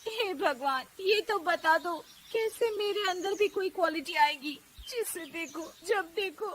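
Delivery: slowing into sobs at the end; tearful plea. Acted. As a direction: lament to God, close to tears. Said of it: "वो रोने लगती है".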